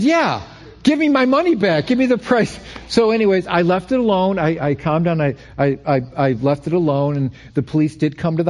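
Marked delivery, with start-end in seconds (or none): 0.0-2.6 mock demanding shout. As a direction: insist like a fed-up customer. Demanding refund from Amazon chat.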